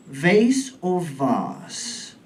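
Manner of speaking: very slowly, "Vase" first rhyming with "face", then "vase" rhyming with "vahz"; two pronunciations. Shown as a demonstration. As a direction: slowly contrast the American "Vase" and the British "vase". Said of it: "problems with the words and the pronunciation".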